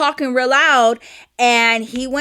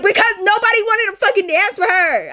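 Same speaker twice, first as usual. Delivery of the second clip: much higher-pitched, indignant protest; exasperated retort; 9 semitones higher than usual. Retold or imitated. Sister defending what she said to her brother, exasperated. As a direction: act out arguing back in exasperation.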